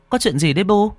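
high-pitched anxious question; alarmed daughter. A narrator's reading. Daughters coming with a lamp to their mother, alarmed. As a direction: call out to mother in a high, worried voice.

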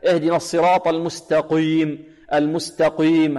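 المستقيم with qi thickened toward "qwi"; faulty recitation, heavy drawn-out qi in المستقيم; over-heavy qaf. Shown as a demonstration. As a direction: demonstrate the mistake of a too-emphatic qaf in المستقيم. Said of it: "هذا خطأ".

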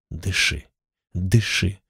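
soft, low "дыши" twice; hushed urging. Acted. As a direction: coax him to keep breathing. Someone urges dying hero.

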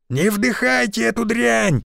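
high urgent warning call; warning shout. Acted. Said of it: "донёсся крик Шишакова". (предостерегающий крик)

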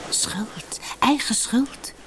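sibilant, unvoiced whisper; hissing. A narrator's reading. Snakes in the grass blame Sina, a hissed whisper. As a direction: hiss it like a snake. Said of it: "siste ze".

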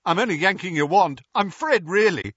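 cheeky twin. A narrator's reading. Twin mocking Moody with a quick joke. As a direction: tease the old auror.

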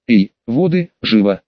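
plain reading voice

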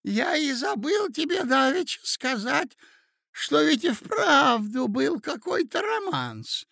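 put-on high, excited old man's voice; doddering old prince. A narrator's reading. Muddled old prince rambling to his nephew, high-pitched.

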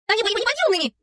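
put-on high-pitched girlish exclamation; exclaiming. A narrator's reading. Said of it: "воскликнула Билли".

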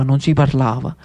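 ordinary talk

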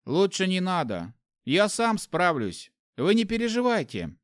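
put-on a boy trying to sound calm; young boy voice. Boy reassures hero.

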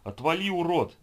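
loud, high furious shout; furious yell. A narrator's reading. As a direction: scream at the monster. Boy kicks the zombie.

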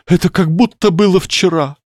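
high plaintive moan. Acted. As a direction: moan it out sorrowfully. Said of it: "простонала она". (со стоном)

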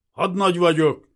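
loud, high, superior announcement; overbearing, barked self-introduction; haughty rasp. A narrator's reading. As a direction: pull rank arrogantly. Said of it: "recsegte fölényesen".

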